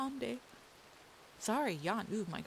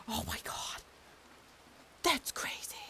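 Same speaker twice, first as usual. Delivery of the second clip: excited whisper; 6 semitones higher than usual. Real, not acted. Excited reaction to telegram numbers.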